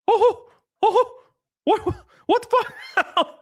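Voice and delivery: real shock that breaks into a high yelp; stunned outrage. Real, not acted. Streamer reacting to fraud news, high stunned outburst.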